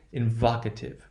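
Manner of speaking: "invocative" as in-VAHK-ative; short open stressed vowel in "invocative". Shown as a demonstration. Teacher drilling the derived word, short value. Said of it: "that will be the short value".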